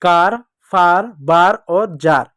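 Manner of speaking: each word read very slowly and clearly; slow recap. Shown as a demonstration. Teacher slowly reading back the words for pupils. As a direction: drill the words slowly for the children. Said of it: "तो".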